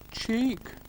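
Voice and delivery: very high, sharp indignant cry; indignant squeak. Acted. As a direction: squeak it out, offended. Train's indignant one-word retort.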